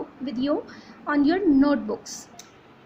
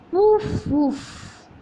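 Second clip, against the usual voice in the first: puffing sounds; blowing on food; 2 semitones higher than usual. Shown as a demonstration. Teacher blowing on hot potato, puffing. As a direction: puff air out as if cooling hot food. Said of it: "and I blow".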